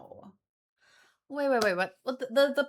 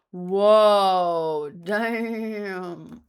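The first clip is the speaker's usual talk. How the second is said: a loud, stretched-out whoa of surprise; real startled whoa, held long; 4 semitones lower, 7 dB louder than usual. Real, not acted. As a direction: blurt it out, caught off guard. Reacting to a surprise gacha roll.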